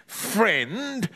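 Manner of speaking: disbelieving snarl through the nose. Acted. Neighbour doubts boy's story, rising snarl. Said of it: "snarled Mister Parker".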